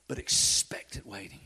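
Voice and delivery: soft whispered emphasis on "expectant". Shown as a demonstration. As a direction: hush it down to a whisper. Repeats phrase, now whispered.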